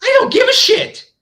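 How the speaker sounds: high exasperated yelp. Real, not acted. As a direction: fling it up in disbelief. Exasperated outburst.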